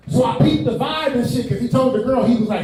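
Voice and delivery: normal talking voice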